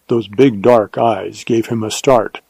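ordinary delivery

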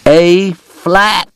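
high, loud, sharp shout. A narrator's reading. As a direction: bark the note name at the piano. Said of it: "Doc shouted".

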